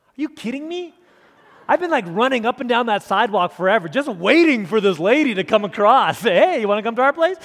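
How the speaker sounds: high, very loud comic outburst; mock disbelief rant. Preacher envying Brad's invitation from the lady, mock disbelief rant. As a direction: shout it in hammed-up incredulous envy.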